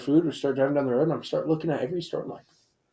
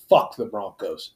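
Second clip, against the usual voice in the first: louder, low and drawn out; retold yell; 6 semitones lower, 6 dB louder, slower than usual. Driver yelling back at a departing officer, retold yell. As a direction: punch out a slow, defiant retort. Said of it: "And I yelled".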